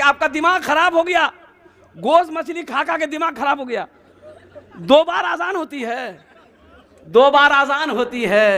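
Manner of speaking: high-pitched mock outrage; indignant crowd. Angry crowd confronts the muezzin. (भीड़ की नाटकीय नाराज़गी, ऊँचे सुर में)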